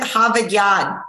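Boston accent with dropped r's in "Harvard Yard"; broad Boston vowels. Shown as a demonstration. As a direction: say "Harvard Yard" as "Hahvahd Yahd". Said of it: "say that in your best Boston accent".

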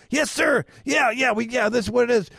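high-pitched, over-assuring imitation; eager scammer. Scammer confirms gift card payment to victim, eagerly assuring.

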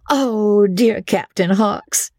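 high, gushing ladies' voice; two gushing women. A narrator's reading. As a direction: coo it like two flirts. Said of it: "they cried".

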